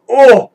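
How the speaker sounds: short loud pained oh; painful groan. Acted. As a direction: groan out in sudden pain. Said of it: "let out just one painful groan".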